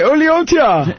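high mock Ugandan call; Ugandan exclamation. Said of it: "They say".

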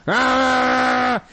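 high, loud mock shouting sound; imitated wordless yell. What the kid hears from yelling dad: loud Ah. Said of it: "All the kid hears is".

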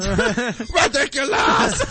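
laughing shout. Acted. Mock-outraged shout.

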